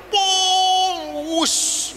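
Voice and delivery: high mock whoosh of a speeding car; car whoosh noise. Priest mimics the speeding car to the congregation, whooshing. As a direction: make the car zoom past.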